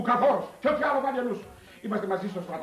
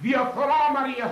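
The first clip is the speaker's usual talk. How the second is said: louder and higher, with a rising wail; anguished cry; 5 semitones higher, 4 dB louder than usual. Acted. Priest wailing about corruption to Maria, anguished cry. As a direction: cry the word out like a lament.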